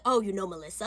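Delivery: small, deflated mock-nervous voice; caught out. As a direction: play a cheater shrinking as he's caught.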